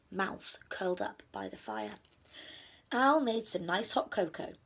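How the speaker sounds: ordinary delivery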